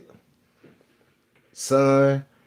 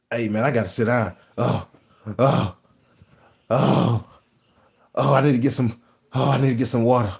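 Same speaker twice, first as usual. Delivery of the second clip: mock exhausted groan. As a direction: pretend panting coworker moaning for water. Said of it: "So he be like".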